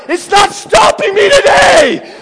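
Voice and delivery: very high, slow, loud battle cry; defiant shout. Retold or imitated. Chasing pranksters out of the shower, defiant yell. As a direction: yell it out, determined and wild.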